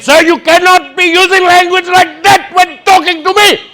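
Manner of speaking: high, loud shouting officer; officer losing his temper, yelling; mock enraged rent-a-cop; angry officer. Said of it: "he got very angry with me".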